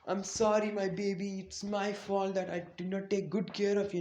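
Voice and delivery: high, pleading acted wail; mock crying out. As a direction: imitate a distraught mother begging forgiveness. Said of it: "cry out very loud".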